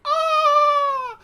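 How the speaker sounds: very high, loud delighted shriek; ecstatic squeal. Retold or imitated. Streamer imitating his reaction to a new mod, ecstatic squeal. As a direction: squeal with joy. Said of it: "And I'm like".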